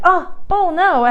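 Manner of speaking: high, slow, theatrical oh no; mock surprise. Shown as a demonstration. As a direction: fake an oops to distract the child. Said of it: "I'm like".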